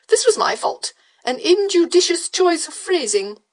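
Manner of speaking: a breathy fop's high apology; foppish beau apologising. Acted. Beau apologising to the widow, high airy fop.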